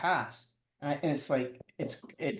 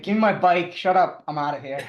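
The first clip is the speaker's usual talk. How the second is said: mock annoyed; 4 semitones higher, 5 dB louder than usual. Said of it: "I was like".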